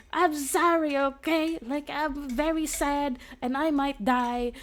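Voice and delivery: high, loud, slow melodramatic whine; mock whiny girl. Captive girl apologising to the defender, hammed-up high whine. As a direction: ham up a weepy heroine. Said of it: "And she's like".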